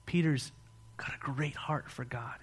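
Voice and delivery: ordinary delivery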